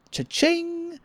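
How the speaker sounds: very high sung cha-ching; high mock register noise; cash register sound. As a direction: ring it like a till.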